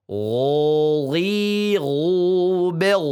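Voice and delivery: "Gho, ghi, ghu, bel" with a smooth throat gh, no rasp; smooth gargled ghain chant; ghain drill. Shown as a demonstration. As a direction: glide "Gho" from the upper throat. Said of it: "tidak ada suara serak-serak".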